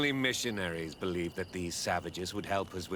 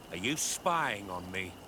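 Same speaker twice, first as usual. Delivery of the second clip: ordinary talk, nothing marked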